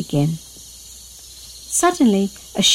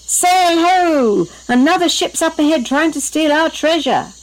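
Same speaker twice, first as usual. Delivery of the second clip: high, urgent lookout's cry; alarmed storybook pirate call; shouted warning; 8 semitones higher than usual. A narrator's reading. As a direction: raise the alarm to the crew, pitched up. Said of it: "suddenly gave a warning".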